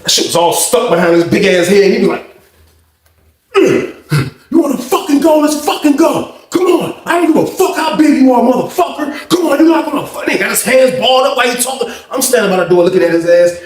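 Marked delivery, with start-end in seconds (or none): 3.5-10.2 squaring up; mock fight challenge.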